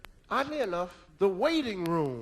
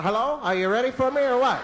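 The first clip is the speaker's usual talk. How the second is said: comic loud call from inside; hollering baby; 3 semitones higher, 7 dB louder than usual. Retold or imitated. Unborn kid hollering to the parents, loud impatient call. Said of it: "the kid is hollering".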